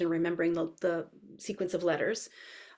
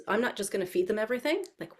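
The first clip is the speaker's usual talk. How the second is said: ordinary talk, nothing marked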